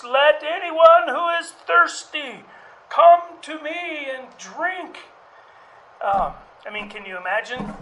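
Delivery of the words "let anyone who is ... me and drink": high, loud and slow, calling out to the whole crowd; loud proclamation. Acted. Jesus calling out to the festival crowd, loud and slow. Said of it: "and said in a loud voice".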